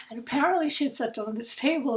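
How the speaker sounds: ordinary talk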